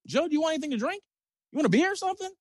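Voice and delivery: imitated high-pitched, coaxing question; solicitous agent. Agent asks his silent star about drinks, high and solicitous.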